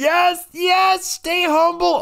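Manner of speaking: high, excited victory cry; gloating cheer. Real, not acted. Winner crows at his struggling brother, gloating cheer. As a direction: crow over the win in a high voice. Gloating over winning arm wrestle.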